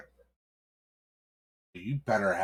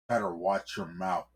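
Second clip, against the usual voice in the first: usual speaking voice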